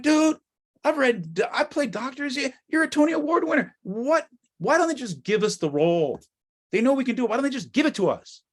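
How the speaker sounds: high, fast aggrieved rant; exasperated actor venting to a colleague. Retold or imitated. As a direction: complain like you've been robbed of the role. Retold rant at Oba.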